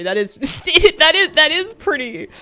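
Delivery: ordinary talk, nothing marked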